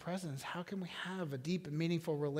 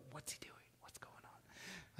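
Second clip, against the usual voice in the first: hushed, puzzled whisper; mock audience whisper; 2 semitones higher, 19 dB quieter than usual. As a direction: whisper it like a confused onlooker. Congregation's imagined murmuring during silence.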